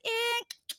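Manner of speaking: high, squinty eh with a click; high-pitched hesitant eh; squinting eh. Retold or imitated. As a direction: imitate the unsure cook's squint-eh. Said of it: "makes this face where he squints one eye".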